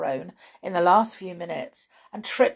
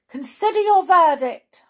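high, loud order; king's command; 12 semitones higher, 11 dB louder than usual. A narrator's reading. King turning hurriedly to the jury, high loud command. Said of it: "he said to the jury".

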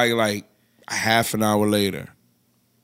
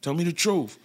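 softer mock-stern demand; retold dead serious; 6 semitones higher, 6 dB quieter than usual. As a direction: repeat it quietly, imitating an unamused caller. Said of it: "Dead serious".

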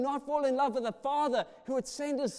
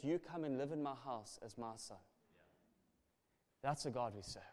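tender hush; 9 semitones lower, 11 dB quieter than usual. Real, not acted. Tender climax of the story.

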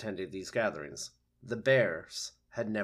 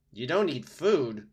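higher, surprised fox; 5 semitones higher, 8 dB louder than usual. A narrator's reading. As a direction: fox perking up. Fox character.

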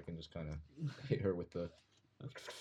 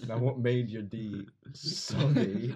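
slow, louder question through laughter; laughing question; 5 dB louder, much slower than usual. Real, not acted. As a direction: ask it while cracking up. Laughing at the soggy joke.

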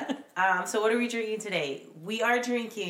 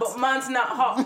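laughing quote; 4 semitones higher, 5 dB louder than usual. Retold or imitated. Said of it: "Man Never Gets Hot?".

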